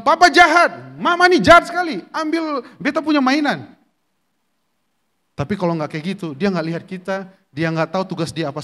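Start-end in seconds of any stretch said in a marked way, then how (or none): 0.1-3.7 put-on high, loud childish whine at the parents; a sulking kid protesting his phone was taken; whiny angry child. Child complaining to parents after phone taken, high whiny protest. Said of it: "Dia marah, dia kesal. Dia bilang".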